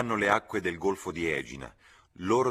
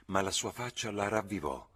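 ordinary delivery

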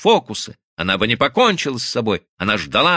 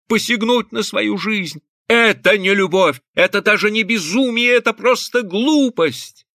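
high-pitched, rising outrage; outraged scolding; 9 semitones higher than usual. A narrator's reading. Husband rebukes his wife for the attempt, outraged. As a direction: scold vehemently, pitch well up.

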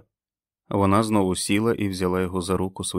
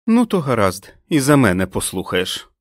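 loud, pitched-up dismissal; angry retort; 4 semitones higher, 7 dB louder than usual. Acted. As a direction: throw it back in a huff. Fran storms off. (роздратовано й голосно)

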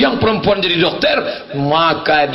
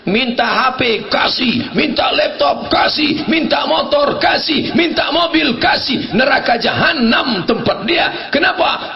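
raised, emphatic sermon delivery; impassioned preaching; 7 semitones higher than usual. Real, not acted. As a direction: drive each point home to the congregation. Preaches forcefully on raising children.

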